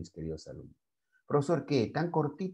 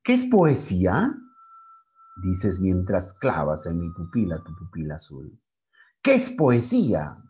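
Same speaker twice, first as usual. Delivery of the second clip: declaiming a poem; 6 dB louder than usual. Shown as a demonstration. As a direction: recite Bécquer, questions ringing out, then dropping at the end. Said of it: "entonces bajo aquí los signos de me hace decir".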